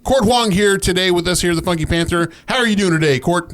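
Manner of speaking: show announcer intro. Pretend. Host runs back his podcast opening, hyped announcer delivery. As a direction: welcome the guest like a hype man. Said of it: "Run it back".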